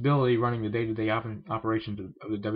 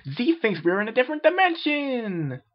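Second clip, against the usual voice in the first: odd character voice; 6 dB louder than usual. Acted. Z speaks of himself.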